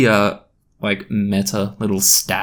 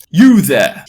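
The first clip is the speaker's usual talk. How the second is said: loud, higher-pitched sudden yell; 6 semitones higher, 9 dB louder than usual. A narrator's reading. Teacher turning on a chattering pupil, yelled. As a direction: yell it across a classroom. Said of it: "he yelled".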